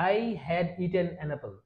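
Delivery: I had eaten an apple said slowly and softly; slow full form. Shown as a demonstration. Teacher gives the full form before the contraction. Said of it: "কিন্তু আমরা চাইলে এটা বলতে পারি কি?".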